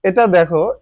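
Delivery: usual speaking voice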